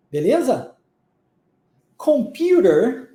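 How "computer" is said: slow American "Computer" with a closed R; American accent, curl the final R; American English. Shown as a demonstration. Teacher contrasting with British English, American "Computer" drawn out. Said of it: "no inglês americano e canadense ser mais fechada".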